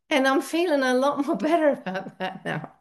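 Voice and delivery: talking through real chuckles; laughing. Real, not acted. Speaker admitting to the group they feel better, laughing. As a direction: let laughter break into the words. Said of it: "I'm laughing because".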